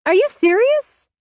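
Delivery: high, loud disbelieving question; incredulous outburst. A narrator's reading. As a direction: fire it back in outrage. Angry daughter in phone quarrel.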